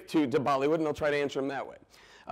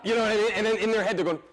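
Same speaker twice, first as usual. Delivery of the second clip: ordinary talk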